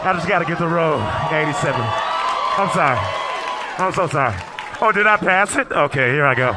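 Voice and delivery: loud flustered apologizing; loud apologetic. Acted. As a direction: call it out over the seats. Squeezing past passengers, loud.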